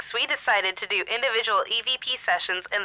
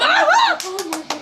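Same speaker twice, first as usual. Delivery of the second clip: burst of laughter; 8 dB louder than usual. Real, not acted. Reacting to makeup answer.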